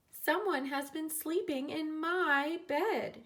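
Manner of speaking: mother surprised, drawn out; mother octopus. A narrator's reading. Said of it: "she said".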